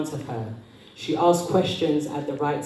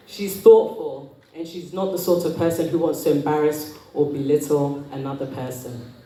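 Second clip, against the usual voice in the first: usual speaking voice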